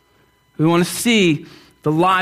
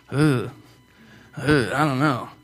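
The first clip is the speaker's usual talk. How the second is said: reluctant groan; 8 semitones lower, 5 dB quieter, slower than usual. Retold or imitated. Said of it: "Some of you guys are like".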